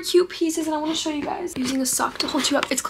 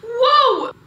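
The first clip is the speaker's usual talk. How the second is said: surprised whoa; 12 semitones higher, 6 dB louder than usual. Real, not acted. Surprised exclamation mid-breakdown.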